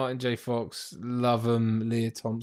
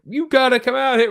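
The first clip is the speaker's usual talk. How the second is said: mock Biff; 12 semitones higher, 7 dB louder than usual. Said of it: "he goes, he's like".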